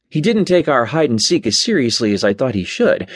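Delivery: plain reading voice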